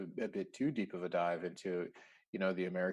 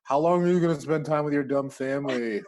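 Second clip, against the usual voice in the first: mock-irritated boss, loud and higher; hammed-up griping at an employee's vacation; exasperated manager; 4 semitones higher, 8 dB louder than usual. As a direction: play the put-out manager, voice raised. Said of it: "some manager, like, in the background".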